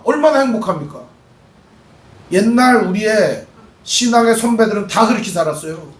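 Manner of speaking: ordinary talk, nothing marked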